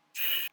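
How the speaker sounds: soft drawn-out shush. Acted. Player hushes the orc, shushing softly. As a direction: give a quick, hushed shh.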